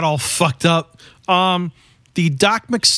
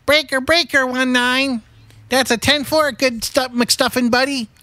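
higher, hammed-up trucker on a CB radio; 6 semitones higher than usual. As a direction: play a trucker calling over the CB radio. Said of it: "a working C B?".

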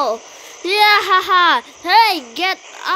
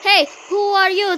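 ordinary talk, nothing marked

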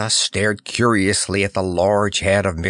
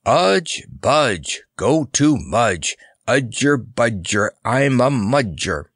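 sing-song verse recitation; recited rhyme; 3 semitones higher, slower than usual. A narrator's reading. Clown recites the silly magic rhyme, in measured chanting. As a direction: chant it in short, even beats. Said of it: "He recited".

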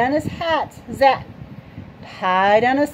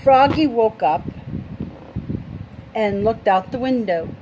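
ordinary delivery

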